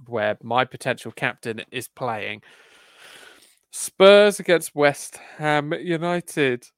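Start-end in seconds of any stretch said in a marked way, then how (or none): 3.7-6.7 slow, loud fixture announcement; dramatic announcer. Acted. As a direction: announce it like a big match billing.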